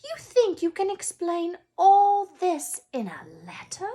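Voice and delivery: high, loud scolding disbelief; McGonagall, indignant. A narrator's reading. McGonagall warning Dumbledore about the boy's fame, high and loud. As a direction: scold him with rising alarm.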